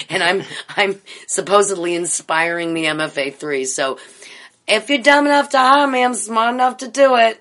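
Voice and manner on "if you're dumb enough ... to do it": high, loud cocky proclamation; boastful shout. Acted. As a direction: belt it out like a showman. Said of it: "I can't believe I just said it like that".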